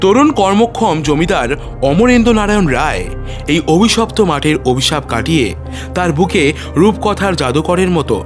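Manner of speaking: usual speaking voice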